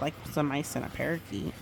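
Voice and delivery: ordinary delivery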